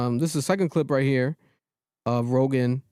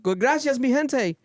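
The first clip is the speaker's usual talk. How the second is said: put-on Spanish accent; 9 semitones higher than usual. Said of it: "he might pull up ... and be like".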